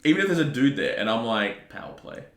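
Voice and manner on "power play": whispered. Retold or imitated. I'm like, power play.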